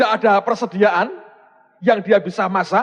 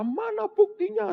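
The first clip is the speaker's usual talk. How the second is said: mock angry demand; 14 semitones higher, 6 dB quieter, slower than usual. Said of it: "Dia marah, dia berkata".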